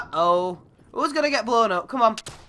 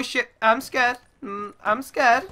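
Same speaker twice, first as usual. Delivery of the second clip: normal talking voice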